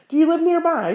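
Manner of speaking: nervous. Acted. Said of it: "she managed at last".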